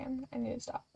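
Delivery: usual speaking voice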